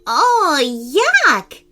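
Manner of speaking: a high, loud gagging yuck; a fairy revolted by healthy food; gagging disgust. Acted. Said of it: "gagged Poppy".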